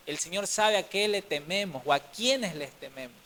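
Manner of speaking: ordinary talk, nothing marked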